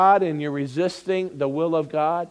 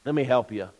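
ordinary talk

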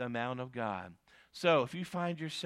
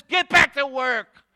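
loud, higher Get back to work; commanding; 9 semitones higher, 10 dB louder than usual. Acted. Preacher voicing God sending Elijah back, loud and commanding. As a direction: bark it as God's command. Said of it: "God says".

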